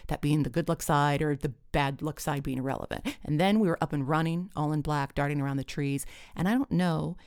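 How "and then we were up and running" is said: usual speaking voice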